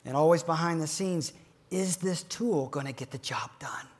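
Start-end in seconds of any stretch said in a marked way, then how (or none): 1.7-3.9 hushed, unvoiced worried question; whispered inner doubt. Real, not acted. Advisor asks himself behind the scenes, in a whisper. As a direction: whisper it to yourself.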